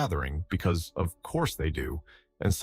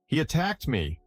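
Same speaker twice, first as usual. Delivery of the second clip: narrated shout without full volume; mock shout; 8 semitones higher than usual. A narrator's reading. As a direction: protest in outrage at a raised pitch. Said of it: "foam cop shouts".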